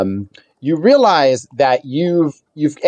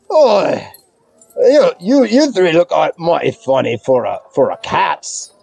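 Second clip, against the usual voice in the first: squint at strangers through a bad hangover; hungover ogreish thug. Hungover mugger sizes up three strangers in his alley, puzzled. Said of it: "bad hangover".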